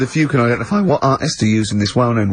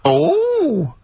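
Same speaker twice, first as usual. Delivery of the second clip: much higher camp character voice; high campy cry of surprise; comic character; 14 semitones higher, slower than usual. Pretend. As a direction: squeal it out as a silly character. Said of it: "he's a new character to me".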